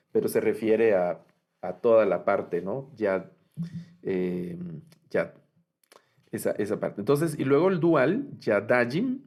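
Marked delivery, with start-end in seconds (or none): none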